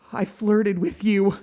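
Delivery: high, loud and slow in horror; aghast at herself; mortified. Acted. Mortified realization.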